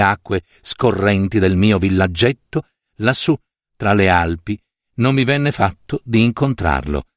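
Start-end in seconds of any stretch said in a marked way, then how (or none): none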